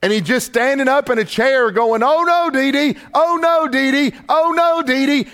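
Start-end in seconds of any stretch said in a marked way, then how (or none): 2.0-5.3 put-on high, panicked little boy's cry; panicked child. Grandfather voicing grandson scared by a movie, high panicked cry. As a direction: cry out like a scared three-year-old. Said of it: "he was absolutely panicked".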